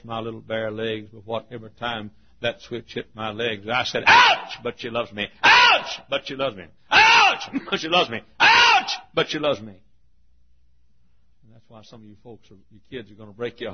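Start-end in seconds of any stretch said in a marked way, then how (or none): 4.1-9.7 high shrieking ouch between sobbing lines; hammed-up child crying out at each lash; mock yelps. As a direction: yelp each ouch sky-high, then cling to she loves me. Child yelping under the switch.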